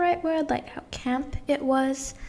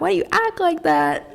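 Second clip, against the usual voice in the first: higher, more incredulous repeat; much higher, baffled repeat; mock exasperated; 8 semitones higher, 4 dB louder than usual. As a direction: push the repeat up in pitch, baffled. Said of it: "And I'm just like".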